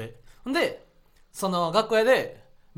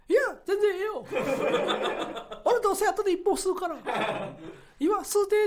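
high-pitched impression of a senior comedian; 13 semitones higher than usual. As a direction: imitate him in a much higher voice. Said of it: "森山さんが". (先輩芸人の高い声真似)

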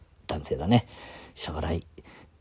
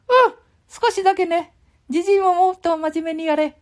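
put-on very high squeaky child voice; high boy puppet butting in; Ken-chan puppet voice; 6 dB louder than usual. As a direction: pipe up high like a cheeky little boy. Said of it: "ケンちゃん。".

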